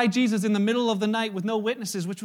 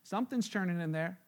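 hushed reflection; 8 dB quieter than usual. Real, not acted. Reflective aside after outburst.

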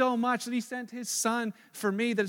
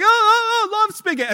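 put-on kids squealing in play; 11 semitones higher, 11 dB louder than usual. Boys playing pillow fight game.

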